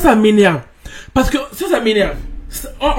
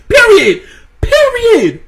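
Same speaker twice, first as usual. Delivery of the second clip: laughing; 13 semitones higher, 5 dB louder, much slower than usual. Real, not acted. Rire hystérique.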